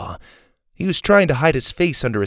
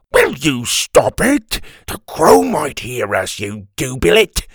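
loud, exasperated goon; exasperated scolding; 2 semitones higher, 5 dB louder than usual. A narrator's reading. Big man telling off the old man, loud and angry. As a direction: scold him hard, in a big man's voice.

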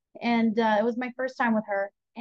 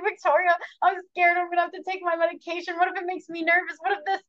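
high, fast retold worrying; scared; 6 semitones higher, faster than usual. Patient phoning her sister about new medication, mock-panicked and scared. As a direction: act out a nervous rush of what-ifs. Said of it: "at first I was so scared".

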